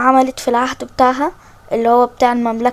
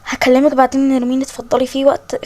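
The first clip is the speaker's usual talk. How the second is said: ordinary talk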